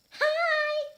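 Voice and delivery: high, loud flirty hello. Acted. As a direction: purr it up high at him. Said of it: "I replied flirtily".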